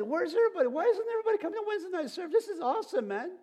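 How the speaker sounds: preacher mocking members who complain about serving; whiny griping. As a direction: whine like a put-upon church worker. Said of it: "Everyone said".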